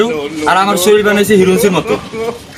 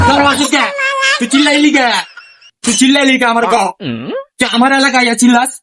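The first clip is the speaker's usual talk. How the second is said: higher, hammed-up angry challenge; angry confrontation; 5 semitones higher than usual. Local tough confronts a stranger shouting in his area, mock angry. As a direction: bark at him for yelling on your turf.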